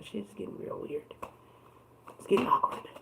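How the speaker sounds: breathy embarrassed whisper; awkward whisper. Real, not acted. Reader fumbling her words drops to an awkward whisper. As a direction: drop to a sheepish whisper. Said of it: "I don't know why the fuck I can't talk".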